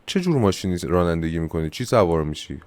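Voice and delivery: ordinary talk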